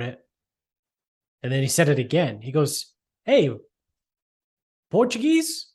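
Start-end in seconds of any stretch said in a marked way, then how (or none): 3.2-5.7 high, prying stranger's question; lightly hammed golf-course busybody; mock nosy stranger. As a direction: mimic the guy butting in, pitched way up. Stranger quizzing him, voiced.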